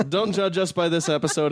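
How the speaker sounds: laughing. Real, not acted. Laughing sign-off.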